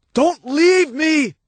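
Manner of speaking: high, slow drawn-out plea; pleading cry. Acted. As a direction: beg her not to go. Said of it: "he called out to her".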